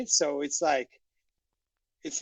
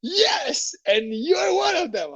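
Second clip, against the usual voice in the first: high, accusing mock retort; mock scornful lady; 13 semitones higher, slower than usual. The lady tells the salesman he is one of them, with a high scornful retort. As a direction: spit it at the salesman. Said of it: "She's like".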